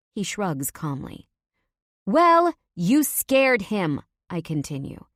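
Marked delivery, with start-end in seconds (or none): 2.0-4.0 loud, high, slow accusation; angry. A narrator's reading. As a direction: spit it out indignantly. Talia scolding Leo.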